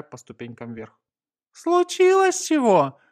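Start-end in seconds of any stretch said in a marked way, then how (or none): 1.5-3.0 high, slow, loud alarmed question; wary question. A narrator's reading. Gonza hearing of urgent orders, high wary question. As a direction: ask on alert, pitched high. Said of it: "настороженно спросил Гонза".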